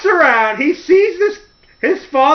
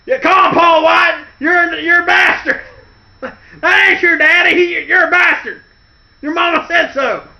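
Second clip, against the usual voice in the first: high, loud jeering yell; screaming taunt; 10 semitones higher, 6 dB louder than usual. Retold or imitated. Boss screaming insults from megaphone car, jeering yell. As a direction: scream the insult across the cemetery.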